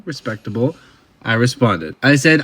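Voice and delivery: ordinary talk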